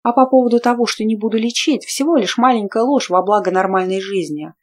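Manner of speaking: usual speaking voice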